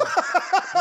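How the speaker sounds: high, loud laugh; burst of laughter. Real, not acted. Boxer tickled by a teasing question, laughing out loud.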